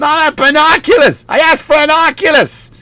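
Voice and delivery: high Boston quarrel impression; arguing family. Family falling apart over present, high mock protest. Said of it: "watching his family fall apart".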